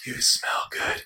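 high eerie whisper; creepy whisper. Shown as a demonstration. Demo of the creepiest compliment, high whisper. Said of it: "the creepiest way that someone could say".

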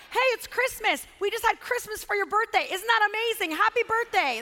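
hammed-up enthusiasm; mock parental excitement. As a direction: oversell it like a cheery parent. Mock parent pitching combined birthday gift.

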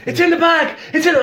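panicked friend. Retold or imitated. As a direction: squeak it like the delirious patient. Panicked friend voiced high.

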